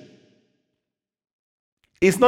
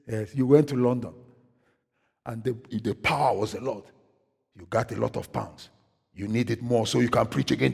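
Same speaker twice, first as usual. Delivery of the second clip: low, soft voice, imitating God; quiet divine reproof; 7 semitones lower, 8 dB quieter than usual. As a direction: drop low and quietly lay out the facts. God's retold reply to the pastor.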